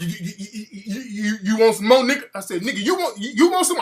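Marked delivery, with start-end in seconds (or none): 0.0-2.2 stammering over and over on you; stuttering. Retold or imitated. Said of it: "I'm stuttering now". 2.6-3.8 stammering, pushed higher; stuttering. Retold or imitated. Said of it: "I'm stuttering now".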